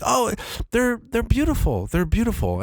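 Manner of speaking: high, hesitant wife voice; polite but let down; wife's forced politeness. Retold or imitated.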